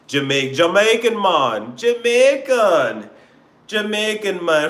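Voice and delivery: playful put-on Jamaican accent, drawn out. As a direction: try out a comic Jamaican accent. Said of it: "I love that Jamaican Caribbean".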